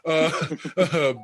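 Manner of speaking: breaking into real laughter mid-list; can't get the words out for laughing. Real, not acted. Host reading the awards list cracks up laughing. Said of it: "I can't even say this without laughing".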